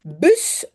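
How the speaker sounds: short u in "Bus"; "Bus" with a quick rounded front vowel. Shown as a demonstration. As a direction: say "Bus" with a brief French u as in "jus". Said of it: "c'est comme jus".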